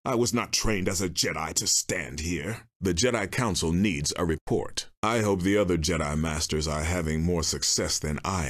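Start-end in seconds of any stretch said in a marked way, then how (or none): none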